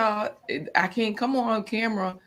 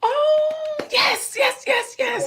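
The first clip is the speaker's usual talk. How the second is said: excited cheer; much slower than usual. Real, not acted. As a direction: cheer like your side just won. Cheering poll result garden meat.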